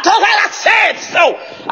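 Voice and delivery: high, long-drawn declaration; emphatic yell. Shown as a demonstration. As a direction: cry it out, stretching each word. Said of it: "I can't just say it".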